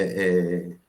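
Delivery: ordinary talk, nothing marked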